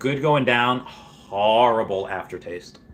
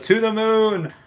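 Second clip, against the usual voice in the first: excited cheer; 10 semitones higher, slower than usual. Retold or imitated. Reading a hyped chat cheer.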